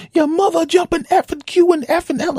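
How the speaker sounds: high, loud censored swearing; mock cursing rant. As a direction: squeal the curse letters furiously. Angry driver cursing at window.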